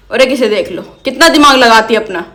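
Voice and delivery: ordinary delivery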